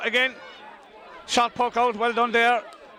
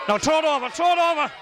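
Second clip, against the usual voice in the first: urgent urging; 6 semitones higher than usual. Real, not acted. Urging player to shoot.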